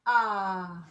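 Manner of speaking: a wide-open "Ah", mouth open; saying ahh. Shown as a demonstration. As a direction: open "Ah" like at the doctor's. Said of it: "pretend that you're at the doctor's".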